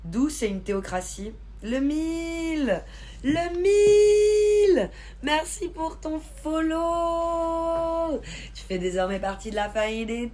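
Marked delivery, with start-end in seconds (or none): 1.6-4.9 high, long drawn-out shout of a name; excited greeting shout. Real, not acted. Streamer greets a viewer joining the live, excited shout. 5.2-8.2 high, long-held thank-you cheer; excited shout-out. Real, not acted. Streamer thanks a viewer for the follow, excited shout-out.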